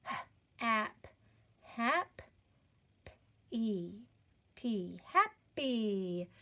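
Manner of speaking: "H" as a bare puff, then "ap", blended to "hap"; sounding out. Shown as a demonstration. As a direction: build "happy" in separate sounds, then a slow blend. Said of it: "Now, let's do our sounds".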